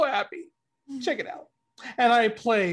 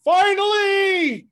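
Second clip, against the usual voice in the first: excited cheer; 9 semitones higher, 7 dB louder, much slower than usual. Real, not acted. Celebrating voice returning.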